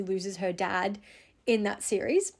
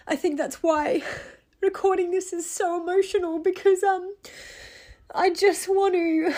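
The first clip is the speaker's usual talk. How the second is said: tearful; 7 semitones higher than usual. Real, not acted. Recording a grief podcast for listeners, voice breaking with tears. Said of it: "through this voice and sniffling".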